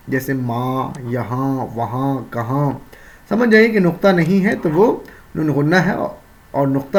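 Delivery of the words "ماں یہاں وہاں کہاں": ماں، یہاں، وہاں، کہاں with the final n sounded only through the nose; nasal noon ghunna. Shown as a demonstration. Teacher giving examples of noon ghunna, nasal endings on ماں، یہاں، وہاں، کہاں. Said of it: "جس کی آواز ناک سے نکلے".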